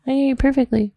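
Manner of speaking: loud. Real, not acted. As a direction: call it out loud. Raising voice so she hears.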